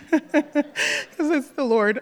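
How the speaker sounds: real laugh, drawn out slowly; a real laugh breaking out mid-confession; emotional laugh. Real, not acted. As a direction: laugh through the tears and slow down. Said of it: "sorry, I'm so emotional".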